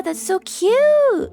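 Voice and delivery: high, loud, slow gushing coo. Real, not acted. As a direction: melt over something adorable. Gushing over a cute drawing.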